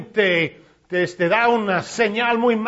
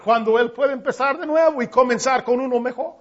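ordinary talk, nothing marked

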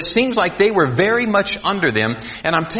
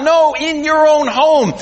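emphatic; 9 semitones higher, 4 dB louder than usual. Acted. Preacher insisting.

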